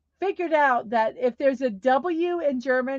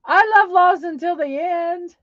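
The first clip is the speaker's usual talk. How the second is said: high, loud fake wail; hammed-up sob over a TV finale; mock crying; 8 semitones higher, 6 dB louder than usual. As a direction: whine it like a heartbroken fan. Mock lament over Lost ending.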